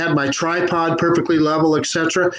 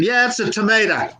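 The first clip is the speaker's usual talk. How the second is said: American accent; 4 semitones higher than usual. Shown as a demonstration. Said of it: "say it like an American".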